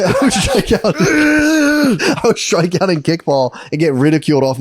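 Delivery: high, slow hammed-up blubbering; mock crying. Kid striking out at kickball, mock-bawling. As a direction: blubber through it as a humiliated kid. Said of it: "I would just cry".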